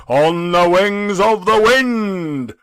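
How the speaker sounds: slow, heavy spectral voice; solemn ghost. Acted. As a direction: weigh each word like a chain. The ghost speaks.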